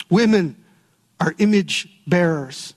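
ordinary delivery

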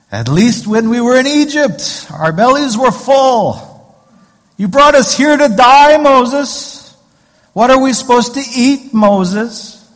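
high, loud, hammed-up whining at the leader; grumbling complaint. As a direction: wail your complaint as an aggrieved crowd. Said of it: "Grumbling and complaining".

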